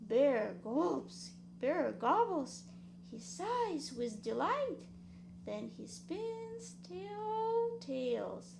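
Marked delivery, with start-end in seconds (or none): none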